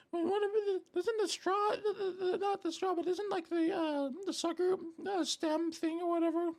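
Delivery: very high, squeaky mock voice; imitated viewers. Streamer mimics chat puzzling over the sucker stick, squeaky mock voice. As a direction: stammer it in a tiny squeaky voice. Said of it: "You guys are like".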